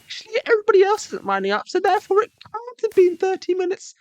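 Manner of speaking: put-on high, fast know-it-all child's voice; smug kid arguing. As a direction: argue back like a cheeky kid. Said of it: "It's like".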